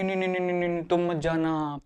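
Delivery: mock weeping, softly, in a run of no's; imitated tearful plea. Preacher mimics people begging someone not to go, mock tearful. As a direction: softly act out someone crying and pleading. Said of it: "रोने लगते हैं".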